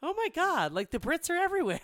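high, loud and fast, imitating a giddy partner; mock excited gush. Partner spotting another Brit on TV, mock excited squeal. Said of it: "he's like".

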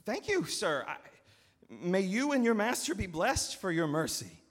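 high, loud, overflowing thanks; grateful farmer. Acted. Farmer thanking the steward, gushing and high. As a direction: pour out thanks to him.

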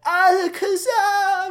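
high, stretched-out mock scream; imitated yell. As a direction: shriek the name like a panicked trainer. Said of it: "he just yells, like".

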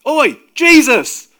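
huge, high, drawn-out yell; full-force urgent shout; top-of-voice shout. A narrator's reading. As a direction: bellow to wake a sleeper. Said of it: "shouted at the top of his voice".

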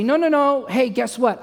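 loud, high, hurried-sounding backpedal; mock panicked reassurance. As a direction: act out desperately talking her round. Acting panicked backpedal to crying wife.

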